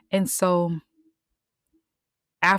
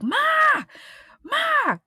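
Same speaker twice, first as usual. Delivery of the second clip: much higher, louder shouted call for mom; 7 dB louder than usual. Retold or imitated. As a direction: yell for mom from outside. Girl runs home calling mother.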